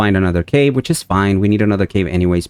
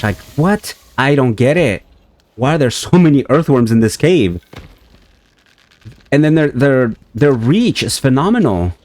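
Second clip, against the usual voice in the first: incredulous outburst; 6 semitones higher, 3 dB louder than usual. Real, not acted. Flood of earthworms.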